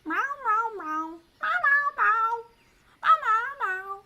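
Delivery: high, loud yowling meows like a cat. Pretend. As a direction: meow like a shrieking kitten.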